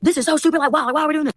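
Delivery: put-on high, whiny teenage complaining; mock whiny teens. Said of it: "like, yelling at me".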